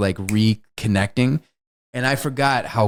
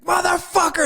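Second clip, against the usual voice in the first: very high, hammed-up outraged curse; mock furious curse. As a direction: spit it out in cartoon rage. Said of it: "and he's like".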